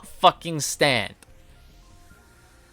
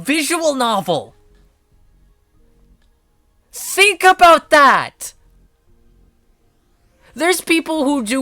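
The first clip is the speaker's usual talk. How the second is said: high, slow, drawn-out exclaiming; outraged rant; 10 semitones higher, 3 dB louder, slower than usual. Real, not acted. Streamer protesting prison for playing a game, outraged rant. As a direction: stretch each phrase in loud disbelief.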